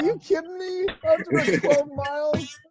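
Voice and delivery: loud, very high mock protest; hammed-up incredulous squawk at an unfair punishment; outraged disbelief. Player outraged at punishment, retold.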